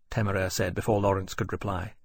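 ordinary delivery, nothing marked

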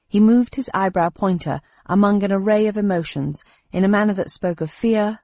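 usual speaking voice

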